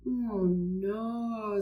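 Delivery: put-on quiet, dismayed sigh of a boy; a soft, sorry Oh, no over the broken bird; sad. A narrator's reading. Arthur finds the glass bird broken, soft and sad. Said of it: "said Arthur sadly".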